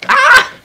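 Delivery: very high, loud shriek; one short piercing yell; sudden scream. Pretend.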